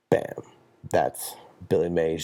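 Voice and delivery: ordinary talk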